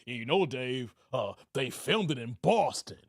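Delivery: low nerdy correction voice; mocking Jermaine. Streamer mimics chatter Jermaine correcting him, low mocking voice. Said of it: "Jermaine was like".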